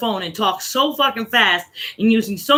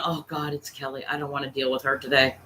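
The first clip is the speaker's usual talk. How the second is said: muttered mock complaint; 7 semitones lower, 9 dB quieter than usual. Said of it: "people going".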